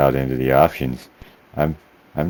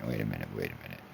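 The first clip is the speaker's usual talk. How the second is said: muttered under breath; 11 semitones lower, 17 dB quieter, much faster than usual. Real, not acted. Muttering while searching menus.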